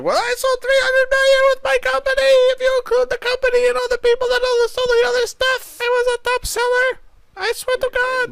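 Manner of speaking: frantic cray-cray squeal, very high; JoJo. Retold or imitated. Host mocking JoJo's crazed job pitch, very high voice. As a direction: ramble wildly in a mock high-pitched voice. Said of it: "cray-cray all of a sudden".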